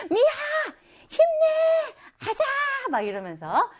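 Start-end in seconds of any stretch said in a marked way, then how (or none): 0.1-2.4 high-pitched rallying cheer. Acted. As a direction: cheer someone on in a loud, high voice. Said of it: "힘을 실어주고 있어요".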